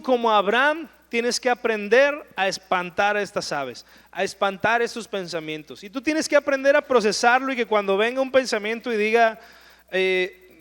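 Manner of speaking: ordinary delivery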